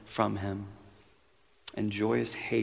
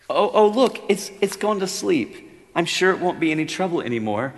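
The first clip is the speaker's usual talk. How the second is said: much higher, louder, anxious coaxing voice; nervous ghost; 9 semitones higher, 8 dB louder than usual. Acted. Ghost pleads with the angel to spare the lizard, anxious.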